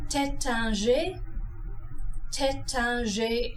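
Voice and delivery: Taittinger slow, French teh-tan-zhay. Shown as a demonstration. Teacher giving the correct French Taittinger. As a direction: Taittinger with nasal middle vowel. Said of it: "One more time".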